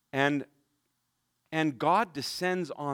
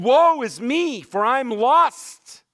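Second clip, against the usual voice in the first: crying out; 8 semitones higher, 8 dB louder, slower than usual. Acted. Isaiah's cry in scripture.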